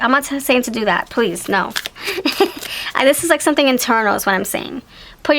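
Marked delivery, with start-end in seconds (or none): 1.8-2.9 airy unvoiced giggle; breathy laugh. Real, not acted. Vlogger laughing at her own joke, airy giggle. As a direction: let a short breathy laugh slip out.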